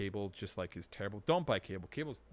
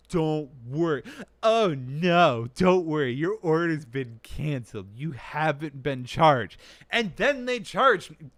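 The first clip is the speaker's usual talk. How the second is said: loud, higher mock reassurance that turns indignant; mocking outrage; 5 semitones higher, 12 dB louder, slower than usual. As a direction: sneer at their fake promises. Mocking support, then outrage.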